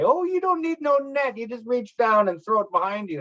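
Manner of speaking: high-pitched elder's voice, dismissive of nets; imitating elders. Elders telling youngsters how they fished, imitated in a high voice. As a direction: voice an elder's easy advice, pitched well up. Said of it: "The elders, like, would say".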